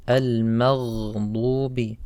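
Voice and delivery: "al-maghdubi" slowly, gargled gh held; clear ghayn. Shown as a demonstration. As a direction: stretch the gh in "al-maghdubi". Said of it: "And also on ghayn".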